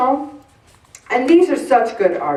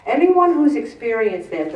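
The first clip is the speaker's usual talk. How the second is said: ordinary talk, nothing marked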